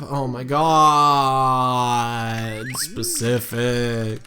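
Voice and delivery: drawn-out, dragging exasperation; exasperated groan. Real, not acted. Groaning at the game character's answer, exasperated. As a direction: stretch each word out in weary disbelief.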